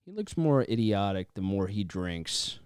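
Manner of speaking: usual speaking voice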